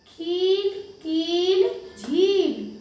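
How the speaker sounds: खील, कील, झील with the ई stretched; teacher stressing long ई to children; long ई words. Shown as a demonstration. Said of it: "खिंच रही है ना".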